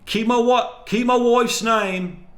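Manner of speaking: high, slow, strained-up impression of a yell. Shown as a demonstration. Showing off an impression of the husband's outburst, high shout. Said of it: "Let me do a [name] voice".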